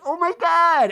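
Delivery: loud, very high put-on exclamation; mock shock. Angie seeing her same dress, loud mock shock. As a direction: cry out as if scandalised.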